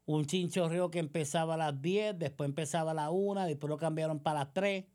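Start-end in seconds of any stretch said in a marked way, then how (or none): none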